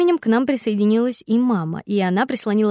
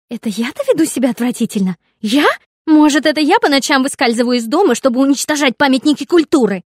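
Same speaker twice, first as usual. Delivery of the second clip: loud, higher, scornful; furious sarcasm; 5 semitones higher, 8 dB louder than usual. A narrator's reading. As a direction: throw it back mockingly. Sister rages at brother. (яростно и язвительно)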